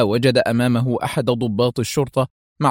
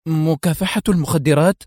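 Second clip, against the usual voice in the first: higher, alarmed echo of the words; frightened; 6 semitones higher than usual. A narrator's reading. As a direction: repeat it, suddenly scared. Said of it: "يغمغم في ذعر".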